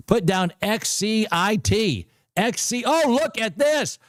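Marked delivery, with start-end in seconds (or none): 2.9-4.0 excited. Real, not acted. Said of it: "If you guys are excited as me".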